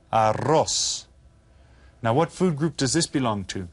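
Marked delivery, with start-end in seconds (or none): 0.1-1.1 "Arroz" with the rr trilled; rolled double R. Shown as a demonstration. Teacher repeating the word after the tip, rolled R. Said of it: "You get to roll that double R there".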